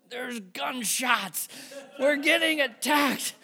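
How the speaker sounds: high, loud mock panic; panicked posts. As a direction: ham up the frightened Facebook crowd. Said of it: "the people went crazy on the".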